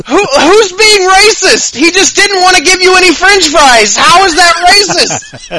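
high-pitched, drawn-out incredulous questions; baffled disbelief. Acted. Voices baffled onlooker answering the accusation.

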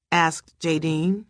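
ordinary delivery

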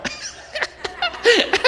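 high, breathy burst of laughter; a real laugh breaks out. Real, not acted. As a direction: laugh out at the memory. Laughing at his own prank.

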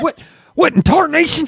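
put-on high old-timer voice with a laugh; hammed-up old prospector. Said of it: "they're always like".

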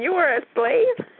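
high-pitched astonished question; shocked disbelief. Acted. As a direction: ask it incredulously, pitch way up. Reacts to shocking slave-ship revelation.

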